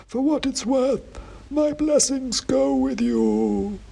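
high, slow, dusty oracle voice; dusty faint ancient head. A narrator's reading. Ancient king's head answering the wizard, slow high dusty chant. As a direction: intone it like a faint, dusty myth.

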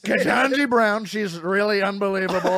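slow, loud Trump voice; loud Trump impression. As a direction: boom it out like Trump. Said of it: "It's a little too loud".

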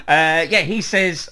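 normal talking voice

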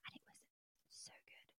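hushed whisper, almost no voice; whispered confession of how good it was. Real, not acted. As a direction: drop to a secret whisper. Whispering about tasty ribs.

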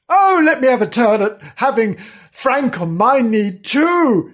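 high, loud, whiny begging; loud pleading. Acted. Idiot sister demanding a cuddle turn, loud pleading. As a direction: beg for a turn at full volume.

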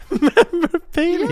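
incredulous. Real, not acted. Friend incredulous at keeping shell.

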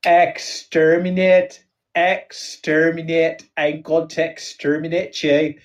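comic Northern Irish Dalek, drawn-out syllables. Acted. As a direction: chant it like a robot from Belfast. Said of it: "do my Northern Irish Dalek".

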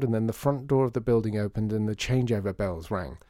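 plain reading voice

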